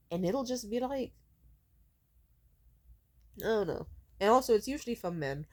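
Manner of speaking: ordinary delivery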